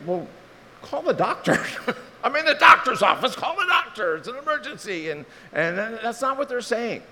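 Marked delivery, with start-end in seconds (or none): 0.1-5.1 higher, louder mock exclamation; comic exclaim. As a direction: blurt it out as the obvious joke answer. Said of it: "I think".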